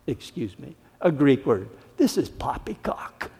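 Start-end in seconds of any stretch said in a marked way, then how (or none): 2.0-3.3 sneering. Acted. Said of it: "some sneered".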